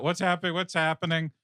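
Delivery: lightly acted alarm, repeating anxiously; mock freaking out. Crew member wakes from hypersleep, mock freaking out. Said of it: "She's, like, freaking out".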